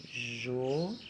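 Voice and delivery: "João" split slowly into JO, pause, ÃO; syllable by syllable. Shown as a demonstration. Teacher separating syllables of João, syllable by syllable. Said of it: "Como que a gente vai separar a sílaba".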